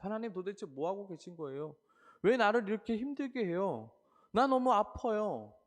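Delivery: preacher voicing a believer's anguished cry; anguished plea. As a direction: cry out to God in pain. Voicing anguished complaint to God.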